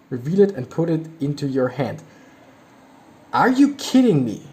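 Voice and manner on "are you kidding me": incredulous. Real, not acted. Disbelief at card text.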